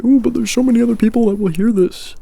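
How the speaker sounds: high, fast mock objection; mock whiny voice. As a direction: mimic a whiny viewer's reply. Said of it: "I don't wanna hear you say".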